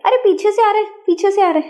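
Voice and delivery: half-playful yell of a crowded line, pitch up on अरे; mock shout in a crowd. Teacher imitating a pushed person in a queue, mock shouting. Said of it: "कोई चिल्ला के क्या कहते हैं".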